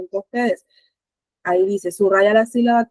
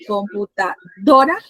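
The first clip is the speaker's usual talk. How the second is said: "computadora" slowly split, DORA louder and rising; stress on DORA; 3 dB louder, slower than usual. Shown as a demonstration. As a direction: draw out "computadora", punching DORA with a questioning lift. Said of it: "a dónde recae la mayor fuerza de voz".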